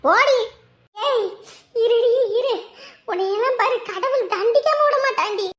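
high, heated threats; angry cursing. Acted. Aunt warning her rival of God's punishment, angry and high.

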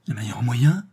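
a touch of groaning dismay; moaning. A narrator's reading. Financier hears of her better plan, moaning. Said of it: "gémit le fermier général".

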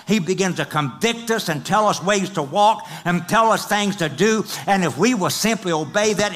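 loud, high, driving sermon climax; preaching at full force. Acted. Preacher stirring up the congregation, loud and rising. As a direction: preach it out to the back row, pushing hard.

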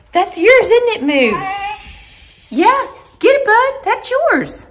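cheering on the dog in a high pet voice. Real, not acted. Cooing to dog about toy.

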